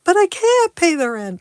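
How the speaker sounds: high, loud pleading damsel; damsel in distress. Acted. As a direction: squeal it like a melodrama heroine. Melodrama skit, the victim.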